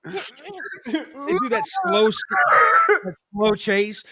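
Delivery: drawn out, high, slowed-down words; mock slow-mo chase; slow-motion voice. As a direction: stretch it like a slow-motion replay.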